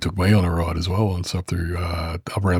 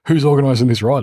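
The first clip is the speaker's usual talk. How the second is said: higher, louder mock-exasperated question; imitated frustration; 6 semitones higher, 5 dB louder than usual. Club member imitating the organisers' frustration with twenty blokes, mock-exasperated. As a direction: voice a fed-up club organiser, pitched up. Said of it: "big frustration for them".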